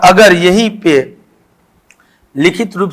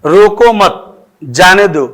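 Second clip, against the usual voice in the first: slow "रोको मत", break, then "जाने दो"; pause after मत; slower than usual. Shown as a demonstration. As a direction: mark the comma after "मत" with a clear break. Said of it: "अगर ये comma यहाँ लग जाता है". ("रोको मत" धीमे बोलकर विराम, फिर "जाने दो")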